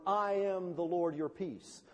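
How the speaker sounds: slow, stretched-out proclamation; solemn divine declaration. Acted. Preacher quoting God's names to congregation, solemn. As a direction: intone it slowly and evenly.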